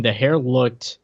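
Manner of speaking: ordinary talk, nothing marked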